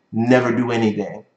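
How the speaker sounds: ordinary talk, nothing marked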